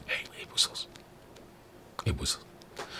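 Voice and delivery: whispered, smug and sly. Retold or imitated. Mocking Russia, a sly smug whisper. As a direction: breathe it out like a gloating spy. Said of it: "With that smile, it goes".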